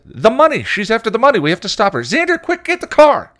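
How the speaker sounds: very high, loud frantic shout; panicked cry. A narrator's reading. Anya panicking about the money, frantic shrieking. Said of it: "Anya cried".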